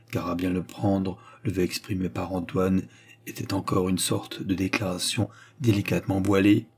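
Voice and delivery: usual speaking voice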